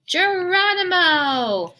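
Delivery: high, drawn-out Geronimo in a put-on voice; big slow whoop before the jump; gleeful battle cry. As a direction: launch yourself off a cliff with joy. Belly flop onto the glue table.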